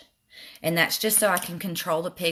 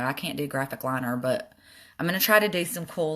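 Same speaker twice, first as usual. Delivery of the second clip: ordinary talk, nothing marked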